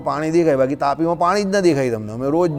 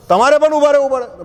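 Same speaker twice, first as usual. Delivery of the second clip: bold call; 7 semitones higher than usual. Retold or imitated. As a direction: call out to halt someone. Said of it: "એ વાત જ એવી રીતે કરે".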